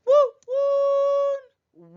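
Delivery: woo woo hooted very high and loud like a train whistle; be the train whistle, high and long. Pretend. Teacher reading the poem to her class, hooting woo woo like a train whistle.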